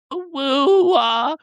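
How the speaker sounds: high, squeaky mocking e-girl; mock e-girl baby voice. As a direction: mimic a cutesy streamer begging for money. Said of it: "doing the baby voice".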